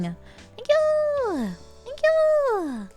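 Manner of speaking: high, squeaky burst of thanks; excited thanks. Real, not acted. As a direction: squeal a delighted thank you. Thanking a new subscriber.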